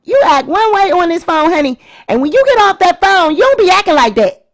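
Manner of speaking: high, loud mock scolding of mom; North. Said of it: "that's what North was trying to say".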